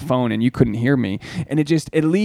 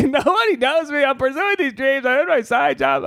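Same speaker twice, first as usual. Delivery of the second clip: mock crying; 10 semitones higher than usual. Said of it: "I'm literally crying".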